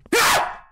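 sudden high shriek; startled scream. Real, not acted. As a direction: shriek mid-sentence from a jump scare. Jump scare cuts speech.

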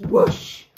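loud breathy rushing whoosh; whoosh sound. Acted. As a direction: blow out the crash of the falling stalk. Beanstalk crashing down.

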